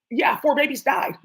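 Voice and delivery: ordinary delivery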